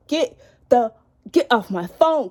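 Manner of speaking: mock angry retort. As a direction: snap at him to get off the phone. Telling the ex off.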